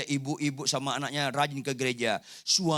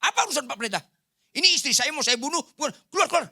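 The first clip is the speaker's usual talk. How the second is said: higher, fast mock rage at the pastor; angry husband; 8 semitones higher, much faster than usual. Abusive husband throwing the pastor out, mock anger. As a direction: throw the pastor out, half-acted fury. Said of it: "Marah dia".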